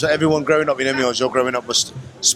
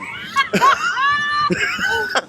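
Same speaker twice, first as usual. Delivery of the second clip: long, high-pitched burst of laughter. Real, not acted. Interviewer cracking up after insisting he is from Brooklyn, high laughter.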